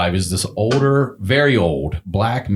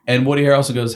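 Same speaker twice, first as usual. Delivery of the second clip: usual speaking voice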